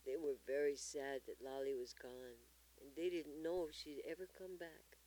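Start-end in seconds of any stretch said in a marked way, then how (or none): none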